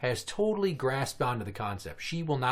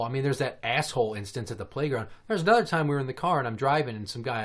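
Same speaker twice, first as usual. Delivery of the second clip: usual speaking voice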